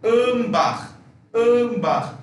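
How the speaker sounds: âmbar with a closed, nasal first A; âmbar said like əmbax. Shown as a demonstration. Teacher repeating a student's word with the closed A. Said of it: "Ese sonido".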